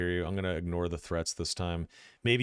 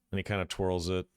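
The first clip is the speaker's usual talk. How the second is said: ordinary talk, nothing marked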